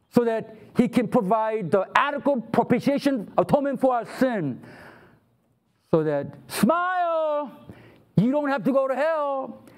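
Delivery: ordinary delivery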